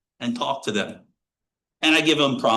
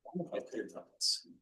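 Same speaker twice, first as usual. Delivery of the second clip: whispered doubt; 12 dB quieter, much faster than usual. Pretend. Voicing someone's regretful inner thought.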